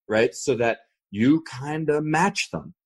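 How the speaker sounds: usual speaking voice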